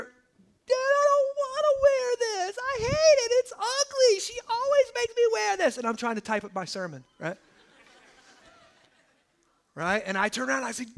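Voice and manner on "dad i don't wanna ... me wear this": whiny little daughter. Retold or imitated. Pastor voicing his daughter complaining about pants, high and whiny. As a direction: play a sulky little girl refusing her outfit.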